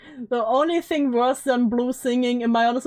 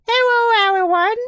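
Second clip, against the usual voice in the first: high, loud, cutesy uwu streamer; 11 semitones higher, 5 dB louder, slower than usual. Pretend. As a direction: squeal a greeting in a put-on uwu voice. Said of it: "I would constantly use the Uwu voice".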